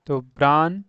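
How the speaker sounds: usual speaking voice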